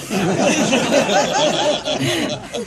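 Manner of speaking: a loud laugh climbing high; loud rising laugh. Real, not acted. Laughing at the studio's regret over hiring him. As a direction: let the laugh rise and spill over.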